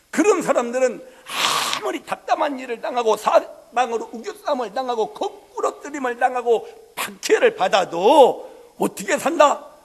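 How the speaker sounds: full-voiced Korean sermon cadence; preaching. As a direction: preach it with rising force. Preaching, building.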